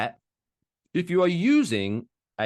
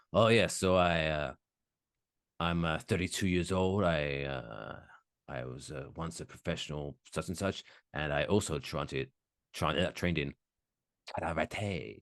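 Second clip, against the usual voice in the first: a hint of an American dub voice, karate said the Japanese way; American dub accent; 4 semitones lower, 5 dB quieter than usual. Mocking the dubbing guy from Physical 100, light American accent. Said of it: "talking in an American accent, going".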